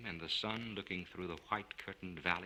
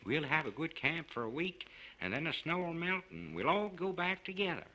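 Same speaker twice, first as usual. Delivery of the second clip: put-on bright, eager young man, voice pitched higher; upbeat, higher voice rallying the camp; cheerful young man; 10 semitones higher, 3 dB louder than usual. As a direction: cheer the group up with youthful gusto. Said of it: "The cheerful gaiety of the young man".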